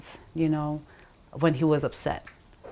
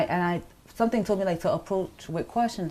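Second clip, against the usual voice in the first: usual speaking voice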